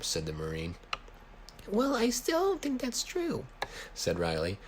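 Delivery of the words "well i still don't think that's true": much higher, slow falsetto; Riley's voice. A narrator's reading. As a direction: stretch it out way up high, stubborn kid. Riley voiced in story dialogue.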